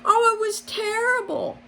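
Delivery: very high distraught cry; frantic. A narrator's reading. Rabbit bursting into Sir Humphrey's parlor, very high and frantic. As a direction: pour out the bad news.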